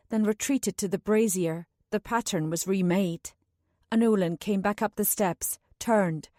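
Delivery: plain reading voice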